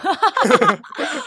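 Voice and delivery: high squealing laugh. Real, not acted. Laughing at own bad English.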